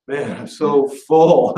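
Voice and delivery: slow, drawn-out overfull sigh of a line; stuffed groan. Retold or imitated. To the audience, after getting off the cruise ship, stuffed and slow. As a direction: drag it out like you can't eat another bite. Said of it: "and you're just like".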